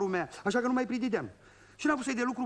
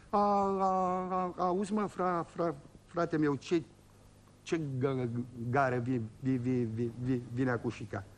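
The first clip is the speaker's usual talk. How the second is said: a lightly voiced old man, stuttering and sinking lower; old peasant stammering out a question about the station; 6 semitones lower, slower than usual. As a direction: stutter through every syllable like a shy old villager. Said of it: "Cam bâlbăit săracul".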